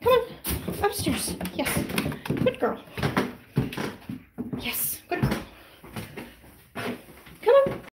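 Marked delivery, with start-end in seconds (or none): 2.4-2.8 high praise voice. Real, not acted. Praising puppy. 7.4-7.8 high excited call. Real, not acted. Calling puppy upstairs.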